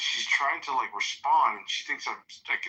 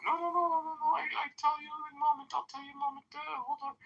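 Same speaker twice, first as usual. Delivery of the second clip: much higher, softer mock of a flustered clerk; imitating the worker; 6 dB quieter, faster than usual. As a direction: play the clerk begging him to wait. Imitates the worker stalling him.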